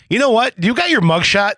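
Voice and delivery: bright high teasing question; playful teasing. Retold or imitated. Host retelling how he teased Cecilia, playfully high. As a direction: tease her about the mugshot.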